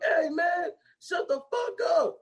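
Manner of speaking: high mock angry yell; angry yell imitation. Retelling the singing scene, high mock yell. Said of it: "he get loud as shit".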